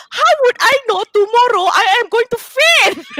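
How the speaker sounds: real tearful outburst; upset crying protest. Real, not acted. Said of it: "I am very emotional about this".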